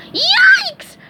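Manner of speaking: very high, loud yelp; frightened squeak. A narrator's reading. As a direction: squeak out in alarm. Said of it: "SpongeBob squeaked".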